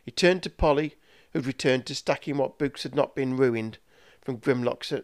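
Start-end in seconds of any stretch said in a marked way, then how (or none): none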